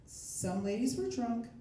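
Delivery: put-on slow, lifted drunk-party energy; like the lively half of the party; high-energy drunk. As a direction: draw it out with lively tipsy energy. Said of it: "energy levels for those two things are very different".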